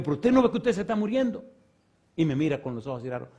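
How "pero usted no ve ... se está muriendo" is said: high, loud retold rebuke; retold shouting. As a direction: bark it at him like you did that day. Retold confronting a dying man.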